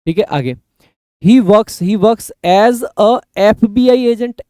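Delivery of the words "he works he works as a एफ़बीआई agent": very slow and higher, stretching FBI; teacher modelling an example sentence; slow example read. Shown as a demonstration. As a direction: read the example slowly so every sound lands. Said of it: "ए का sound है".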